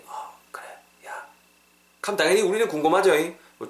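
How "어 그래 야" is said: hushed whisper into the phone; very soft, secretive phone voice. Acted. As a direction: answer a friend's call in a barely audible whisper. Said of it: "왜 속삭인데?".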